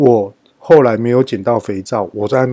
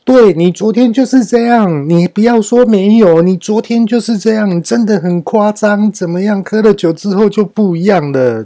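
high, loud nagging scold; imitating scolding wife; 9 semitones higher, 5 dB louder than usual. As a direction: mimic her telling him off, pitched up. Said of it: "就是那种感觉". (模仿老婆高声数落)

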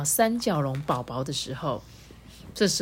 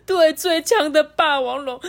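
moved to tears; 10 semitones higher, 6 dB louder than usual. Acted. 不由得抱紧了三角宝宝.

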